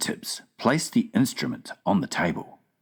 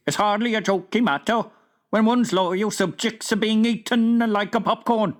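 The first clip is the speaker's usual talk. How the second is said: higher, irritated kingly voice; a king losing patience on the phone; testy King; 13 semitones higher than usual. A narrator's reading. King of Sweden scolds the Queen, testy and raised.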